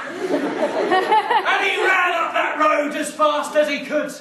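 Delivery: put-on giggling at the boy's flight; laughing narration. A narrator's reading. Narrator laughing while telling the escape.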